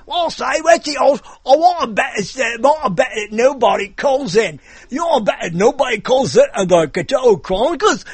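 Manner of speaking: high-pitched, badly faked English bookie; horrible English accent. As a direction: ham up a terrible English accent. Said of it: "that was a horrible English accent".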